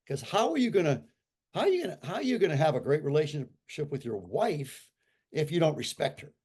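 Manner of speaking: usual speaking voice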